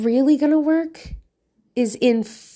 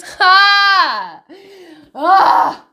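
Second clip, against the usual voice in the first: mock shout; 7 semitones higher, 7 dB louder than usual. Real, not acted. Mock outburst of frustration.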